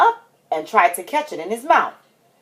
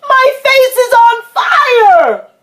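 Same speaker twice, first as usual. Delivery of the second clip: high, loud, stretched wail of pain; hammed-up agonized shout; yelling; 8 dB louder, slower than usual. A narrator's reading. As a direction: howl it as if burning. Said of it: "he yelled".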